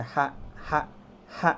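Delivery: "ha" as a thin breath in the mouth; careful demo of airy "ha". Shown as a demonstration. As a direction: let "ha" out lightly, no throat rasp. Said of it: "ngomongnya itu di mulut saja".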